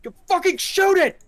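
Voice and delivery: high, loud frantic shout; panicked yell. Acted. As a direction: scream at your buddy to fire. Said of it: "one of them's like".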